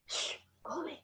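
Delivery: hushed whisper telling him to leave; whispered shush. Acted. Peacock hushes the crow from its cage, whisper. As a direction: whisper it so the visitors won't hear.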